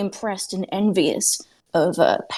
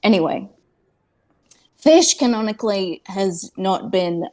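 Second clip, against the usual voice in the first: ordinary talk, nothing marked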